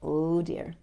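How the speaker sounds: plain reading voice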